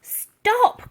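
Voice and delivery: high urgent cry; girl crying out to save her friend; cried stop. A narrator's reading. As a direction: call out to stop him. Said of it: "cried Elsie".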